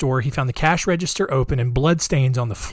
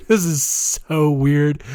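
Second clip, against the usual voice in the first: laughing; slower than usual. Real, not acted. Laughing at the grisly details.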